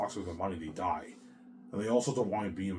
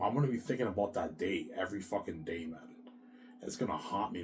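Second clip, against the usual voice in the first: usual speaking voice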